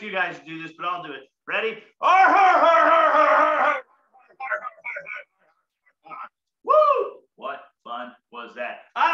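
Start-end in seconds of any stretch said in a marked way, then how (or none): 2.0-6.3 loud high pirate har, fading to breathless; peg leg pirate laughter. Shown as a demonstration. Doing peg leg laughter alone for the crowd. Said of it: "So just do peg leg laughter". 6.6-7.2 very high loud woo; excited whoop. Real, not acted. Whooping after the pirate laugh.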